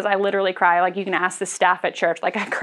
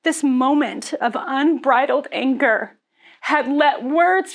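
preaching at full force; 5 semitones higher than usual. Acted. Pastor warning the church about anger, preaching fervently. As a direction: drive the warning home like a preacher.

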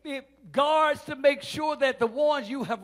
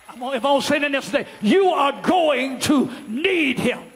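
slow, shouted, higher climb of the preacher; preaching climax; 5 semitones higher, 11 dB louder, slower than usual. Acted. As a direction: build it to a roaring climax, word by word.

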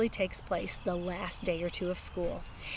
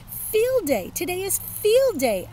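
high, loud gleeful yell; excited shout; 11 semitones higher, 7 dB louder than usual. Acted. Kid shouting the news to her dog Tickle, loud and excited. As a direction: shout the happy news, much higher and louder. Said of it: "I couldn't stop shouting".

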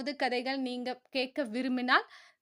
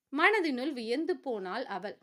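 ordinary delivery, nothing marked

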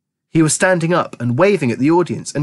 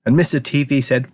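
ordinary delivery

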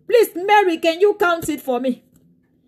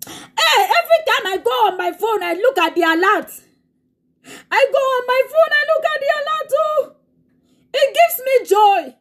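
high, tearful joyful exclamations; overjoyed; 6 semitones higher than usual. Real, not acted. Preacher telling church about the alert, high tearful joy.

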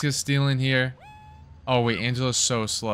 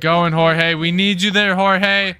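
loud, pressing call to the runner; urging shout; 4 semitones higher, 6 dB louder than usual. Real, not acted. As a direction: push him along the bases.

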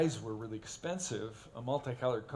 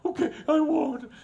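high, louder, imitating a whiny cry; crying little brother; 12 dB louder than usual. Crying little brother giving in, high whine. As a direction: whimper it like a beaten kid. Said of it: "crying, goes".

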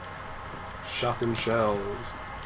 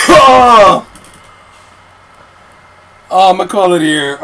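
laughing; 3 semitones higher, 9 dB louder than usual. Real, not acted. Still laughing at outro.

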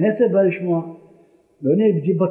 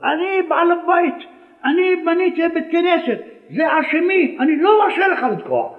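put-on owner shouting down the young shofar blower; angry shout; 11 semitones higher than usual. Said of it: "צעק החכם עובדיה הדיא".

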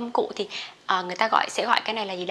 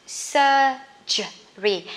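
SURGERY very slowly as "sur-ge-ry"; syllable by syllable; 2 semitones higher, much slower than usual. Shown as a demonstration. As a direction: pause between the syllables of SURGERY. Teacher breaks word into syllables. (đọc chậm SURGERY, tách từng âm tiết)

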